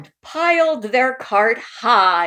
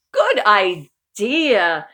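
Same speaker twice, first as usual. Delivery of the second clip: slow, loud drawn-out good idea; hearty refrain; 6 dB louder, much slower than usual. Acted. Uncle Murray's catchphrase agreement, slow and loud. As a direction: savour it like a catchphrase.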